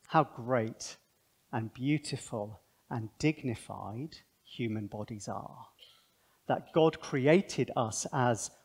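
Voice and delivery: soft, slow, awed sermon voice; hushed reverent preaching. Real, not acted. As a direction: speak low and tender, in wonder. Reverent opening reflection on bodies.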